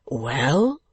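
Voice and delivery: eager. A narrator's reading. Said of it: "said Nicholas eagerly".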